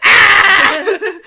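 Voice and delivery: sudden high loud shriek of alarm; mock scream. Joking about a kiss, a loud shrieking scream. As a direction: yelp out a startled scream. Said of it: "Just scream".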